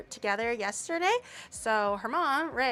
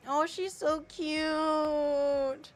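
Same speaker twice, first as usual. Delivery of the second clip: tender gush; 4 semitones higher, much slower than usual. Real, not acted. Gushing over the video.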